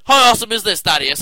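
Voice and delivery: loud hyped question; excited shout. Real, not acted.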